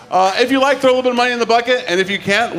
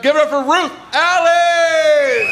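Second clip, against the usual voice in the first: high, drawn-out, stretched introduction; slow hyped-up emcee call; hype announcer call; 7 semitones higher, much slower than usual. Real, not acted. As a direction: sell her name to the crowd like a ring announcer. Host introducing the next comic.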